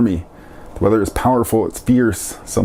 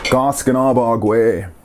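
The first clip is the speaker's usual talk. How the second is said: Goskanabagwe drawn out slowly for learners; slow word model; slower than usual. Shown as a demonstration. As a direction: stretch out Goskanabagwe, unhurried. Teacher models the new word slowly.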